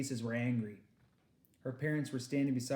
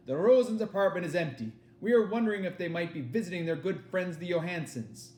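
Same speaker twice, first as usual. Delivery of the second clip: German officer; 4 semitones higher, 6 dB louder than usual. Acted. German soldier speaks.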